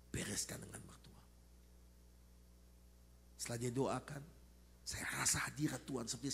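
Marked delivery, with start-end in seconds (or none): 0.1-1.2 soft whispered instruction; whispered counsel. Retold or imitated. Pastor quietly tells doctor to reconcile with mother-in-law, whispering. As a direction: say it close and hushed.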